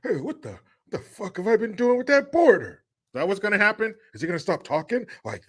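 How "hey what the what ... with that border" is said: Biden waking up. Retold or imitated. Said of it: "Is he gonna wake up and go".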